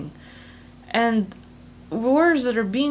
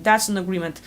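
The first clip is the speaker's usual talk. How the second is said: ordinary talk, nothing marked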